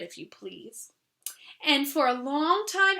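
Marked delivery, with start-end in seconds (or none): 0.0-0.9 soft whispered politeness; whispered afterthought. Acted. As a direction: whisper it so as not to seem greedy. Polite add-on to avoid seeming greedy.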